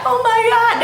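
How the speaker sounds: high, thrilled little squeal of a phrase; excited gush. Retold or imitated. Imitating a happy texter reacting, high and thrilled. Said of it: "You know when you be like".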